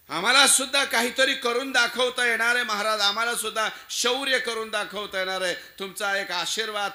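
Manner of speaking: high, loud, impassioned declaration; fervent plea. Acted. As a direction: plead proudly for a chance to prove yourself. Acted fervent plea to the king.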